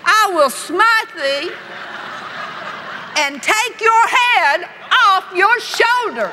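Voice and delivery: high, slow defiant challenge shouted up; mock biblical prophet. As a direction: call up to the giant, daring him. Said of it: "he has to look up like this".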